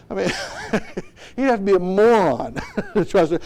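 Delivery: chuckles through the words; laughing. Real, not acted. Preacher laughs at Samson's foolishness, chuckling. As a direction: laugh at the idea.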